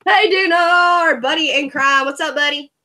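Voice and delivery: usual speaking voice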